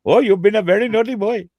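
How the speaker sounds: high, loud mock Indian accent; mock Indian cop. Imagined Indian cop scolding a driver, mock Indian accent. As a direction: put on an Indian accent for a pretend copper. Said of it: "I'm not gonna deal well with the first Indian copper".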